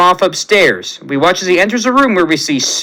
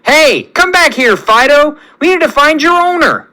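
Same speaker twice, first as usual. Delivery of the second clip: shouting after the dog; 9 semitones higher than usual. A narrator's reading. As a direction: yell it across the park, chasing. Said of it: "as Johnny screams".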